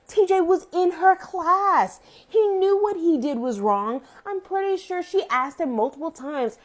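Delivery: high-pitched, emphatic outrage; indignant. Real, not acted. Viewer arguing TJ knew what he did was wrong, indignant. Indignant rant about TJ's cruelty.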